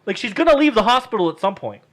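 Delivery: high, loud, insistent comeback; exasperated arguing. Retold or imitated. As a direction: spell it out in frustrated disbelief. Arguing with Keith about her clothes.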